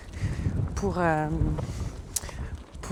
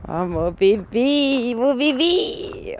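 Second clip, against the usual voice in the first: cooing; 5 semitones higher, much slower than usual. Real, not acted. Cooing over pet snail.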